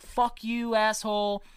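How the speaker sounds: retold jeer at someone's misfortune; mocking jeer. Photographer gloating at the wrecked truck driver, mocking jeer. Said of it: "pointing and laughing like".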